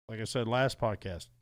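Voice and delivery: normal talking voice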